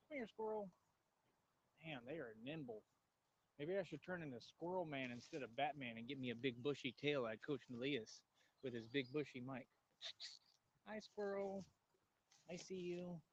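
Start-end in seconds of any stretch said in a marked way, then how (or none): 0.1-0.7 coaxing a squirrel. Real, not acted. 10.9-13.2 cooing to a squirrel. Real, not acted.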